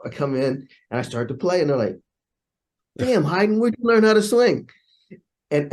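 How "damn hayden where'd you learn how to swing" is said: high, louder astonished demand; retold impressed outburst; amazed reaction. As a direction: exclaim it like a stunned bandmate. Amazed players react to his swing.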